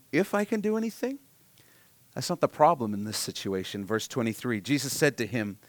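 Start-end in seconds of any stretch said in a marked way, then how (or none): 0.1-1.2 a touch more disbelieving, pitched up; incredulous echo, higher. Retold or imitated. Preacher voicing Jesus echoing the doubting father, higher disbelief. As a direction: repeat the question higher and more amazed. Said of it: "Jesus' response, you'll see, is".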